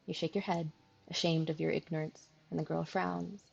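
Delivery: calm storyteller read; story narration. A narrator's reading. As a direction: narrate evenly. Narration between lines.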